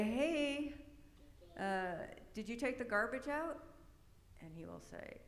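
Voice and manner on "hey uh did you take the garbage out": parent calling out a chore with a slight edge; raised, higher "hey", then a pointed question; not yelling, just an edge in the voice; retold edgy request. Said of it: "You're yelling around the edges of your voice".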